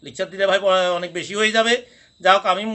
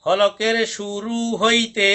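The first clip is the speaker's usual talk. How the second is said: very slow, drawn-out teacher recitation; much slower than usual. Shown as a demonstration. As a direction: stretch each word out for the class to echo. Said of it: "পড়ুন।".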